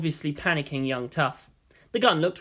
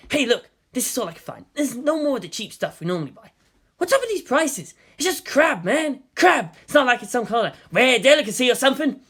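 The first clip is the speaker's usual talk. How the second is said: higher, whiny voiced young agent; griping partner; 8 semitones higher, 4 dB louder than usual. A narrator's reading. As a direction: complain about the crab like it's an outrage. Agent Jiro complains about crab prices.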